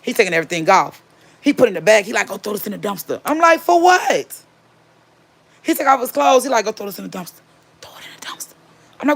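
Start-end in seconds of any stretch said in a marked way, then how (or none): 2.3-3.2 hushed, softer secretive order; imitating a furtive husband; whispering. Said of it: "Why the fuck is you whispering". 3.6-4.3 high, squawked disbelief; imitating her own baffled reply; retold incredulous question. Puzzled reply to whispered order. 6.7-8.5 hushed order fading into pure whisper; imitating a furtive husband's whisper. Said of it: "Why the fuck is you whispering".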